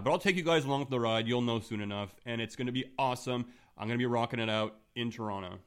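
ordinary talk, nothing marked

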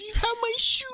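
mock toddler crying. Dad mimics daughter's wail over her dropped shoe, high whiny crying. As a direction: imitate a toddler's weepy "oh no" lament. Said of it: "and she's like".

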